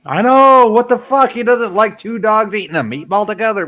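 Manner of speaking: loud, high disbelieving rant; outraged. Real, not acted. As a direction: shout it out in outrage. Outraged rant at Salty Trucker.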